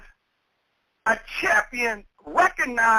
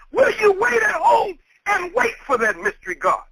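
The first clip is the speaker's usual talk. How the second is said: ordinary talk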